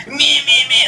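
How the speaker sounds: super fan mimicking guitar players; imitating a friend. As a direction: ham up the friend's whiny voice. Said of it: "when he's talking like this".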